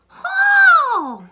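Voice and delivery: very high, loud surprised "Oh!"; shocked gasp. Acted. Teller reacting to the smell, loud shocked cry. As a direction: burst out in mock shock.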